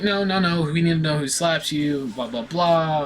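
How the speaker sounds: hammed-up insistence from school staff; insistent teachers. Teachers demanding to know who slapped him, mock insistence. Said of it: "they're like".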